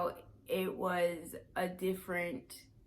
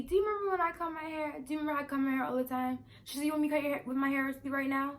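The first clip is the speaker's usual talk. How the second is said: eager; 8 semitones higher, 3 dB louder, much faster than usual. Retold or imitated. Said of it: "she reminded me".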